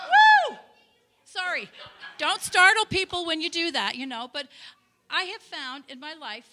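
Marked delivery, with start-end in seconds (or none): 0.1-0.6 high, loud celebratory woo; very high excited woo; joyful whoop. Real, not acted. After the shout, exuberant.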